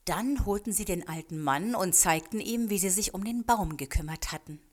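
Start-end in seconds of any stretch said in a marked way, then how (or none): none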